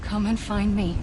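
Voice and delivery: loud, carrying call to someone far away; a loud beckoning call. Acted. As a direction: call out so he can find you across the beach. Calling Sam to find her.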